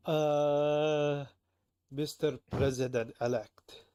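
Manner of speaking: loud, slow attempt at a Scottish accent; hammed-up Scottish detective voice. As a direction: lean into a mock Scottish brogue. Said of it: "Can you do a Scottish accent?".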